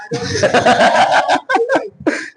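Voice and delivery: long, very high laughing fit; high-pitched, helpless real laughter; self-amused laugh. Real, not acted. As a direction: crack up at your own rambling. Laughing at own rambling.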